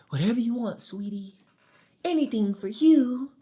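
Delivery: high, sugary pushover voice; mock fawning boyfriend. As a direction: coo it in a high, hammed-up simper. Mocks a fawning, submissive boyfriend.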